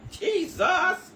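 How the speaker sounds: high, loud cry of Jesus; Jesus flung out loud and rising; shouted Jesus. Real, not acted. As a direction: call out Jesus in shock. Exclaiming in disbelief, half crying.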